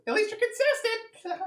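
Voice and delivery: high, slow, mocking creature voice. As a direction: taunt them sweetly. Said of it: "And then it says".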